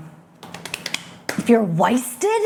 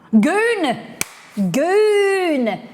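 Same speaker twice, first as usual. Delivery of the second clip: calling out goon; 10 semitones higher than usual. Acted. Calling out slang word.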